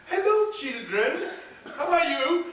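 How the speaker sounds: high, laughing witch greeting; old witch voice. Acted. Old witch welcomes the boy and spider, high and laughing. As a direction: sweeten it like a sly old witch.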